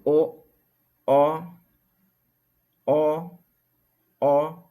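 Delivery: 'O' sung out with lips rounded for O but sounding the A with diaeresis; Ngäbere vowel demo. Shown as a demonstration. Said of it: "Lo hacemos de esta manera".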